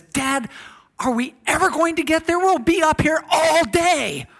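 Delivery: put-on a small boy's high, loud whine; exasperated child. Boy on the plane whines to his dad, high and loud. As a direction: whine up at Dad like a bored kid.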